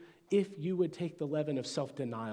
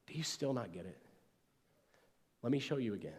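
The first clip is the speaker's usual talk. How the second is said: gentle; 4 dB quieter, faster than usual. Retold or imitated. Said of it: "What if he said".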